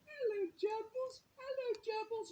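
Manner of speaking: put-on very high, cooing little-boy voice; toddler voice. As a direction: coo it like a delighted two-year-old. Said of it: "And he's going".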